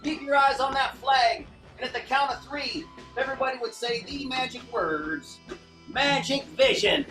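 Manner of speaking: cheerful stage magician patter; kids party magician. Acted. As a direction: coax the crowd like a kids' magician. Magician leads audience participation.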